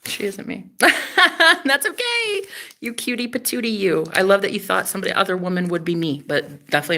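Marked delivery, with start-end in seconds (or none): 0.8-2.4 high, slow words through laughter; giggly and indulgent; amused reassurance. Real, not acted. Laughing off a confusion.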